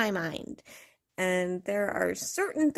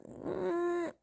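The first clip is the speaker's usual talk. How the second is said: usual speaking voice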